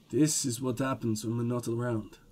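low, soft aside; dry low remark. Acted. Lieutenant murmuring dryly to his partner. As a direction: murmur it wryly.

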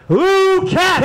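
big contest-winner reveal; winner announcement. Real, not acted. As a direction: call out the winner's name to the room.

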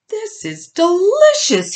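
high, drawn-out delighted surprise; surprised. A narrator's reading. Little pig tasting his new dinner, surprised. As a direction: marvel loudly, pitch jumping up.

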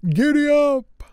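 high, loud giddy-up cry; exclaiming. Acted. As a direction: call it out bright and high like urging a horse. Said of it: "he exclaimed".

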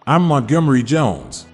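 normal talking voice

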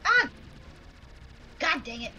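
ordinary talk, nothing marked

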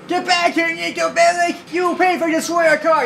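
yelling after a car thief; furious, high, shouting; angry shout. Acted.

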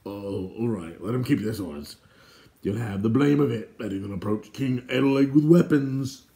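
grudging consent swelling into a warning; grumbling guard. Acted. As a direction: gripe as a disgruntled guard. Guard grudgingly gives in, then warns.

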